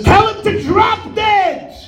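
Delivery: high, slow furious yell at the husband; angry widow shout. Retold or imitated. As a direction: widow hears her gambling husband lost. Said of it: "She says".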